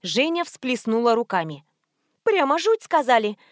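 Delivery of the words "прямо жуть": high, sympathetic dismay; mock horror. A narrator's reading. Nurse reacting to niece's worries, mildly horrified. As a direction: exclaim in lightly voiced horror.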